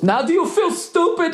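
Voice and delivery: high, sneering mock of the driver; smug taunt.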